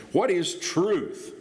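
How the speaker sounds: high, drawn-out derisive question. Acted. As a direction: scoff the question slowly, pitched well up and falling.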